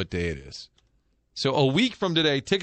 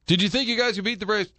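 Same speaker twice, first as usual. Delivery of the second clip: overeager interviewer; 8 semitones higher, faster than usual. Retold or imitated. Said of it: "I'm like".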